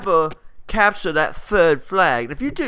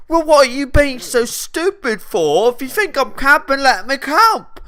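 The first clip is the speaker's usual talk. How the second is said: higher-pitched, exasperated comeback; indignant retort; 8 semitones higher, 3 dB louder than usual. Retold or imitated. Player snaps back at friend over camping, indignant. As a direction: argue back in a raised pitch.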